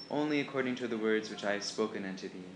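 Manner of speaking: ordinary delivery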